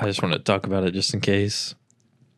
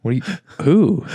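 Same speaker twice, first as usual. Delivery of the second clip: confused; 6 semitones higher, 5 dB louder than usual. Retold or imitated. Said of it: "I'm like".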